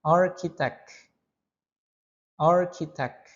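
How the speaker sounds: architect repeated slowly as a model, CH said as K; architect with ki sound. Shown as a demonstration. Teacher repeats the corrected word, architect with a ki sound. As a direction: drill ar-ki-tek for learners. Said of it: "C H I dibacanya ki".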